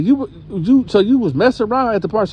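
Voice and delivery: high, fast accusing grandma voice; hammed-up grandma grilling her. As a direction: rattle it off like a suspicious grandma.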